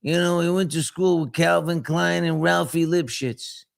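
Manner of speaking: put-on high-pitched Brooklyn old-timer voice; Herbie Nanis, Brooklyn accent. Imitating a late friend from Brooklyn for listeners, high Brooklyn voice. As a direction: talk way up high like a Brooklyn guy. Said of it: "he talked like this".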